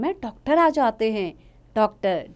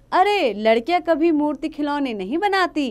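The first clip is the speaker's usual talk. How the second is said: lightly voiced gruff father brushing it off; dismissive father; 6 semitones higher, 4 dB louder than usual. A hint of a father scoffing at his daughter. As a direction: wave her request away.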